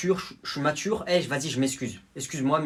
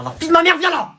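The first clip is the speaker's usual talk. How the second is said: screaming, high and loud; furious shout; 8 dB louder than usual. Acted. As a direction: yell him over. Challenging the defendant to fight.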